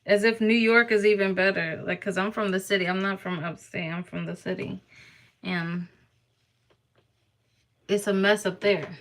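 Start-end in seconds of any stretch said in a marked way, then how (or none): none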